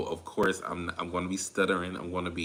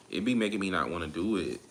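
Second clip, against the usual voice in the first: usual speaking voice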